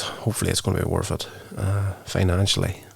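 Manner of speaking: ordinary delivery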